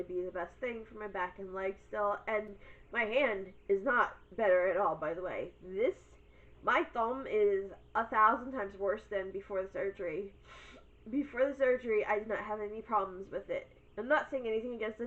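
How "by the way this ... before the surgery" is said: ordinary talk, nothing marked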